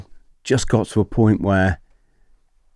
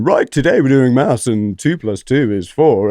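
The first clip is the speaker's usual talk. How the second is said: loud, higher classroom-teacher voice; mock teacher; 5 semitones higher, 5 dB louder than usual. Foreign teachers overheard mid-lesson from under the window, loud mock teacher. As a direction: project a routine maths lesson to the room. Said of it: "the other Gweilo teachers going".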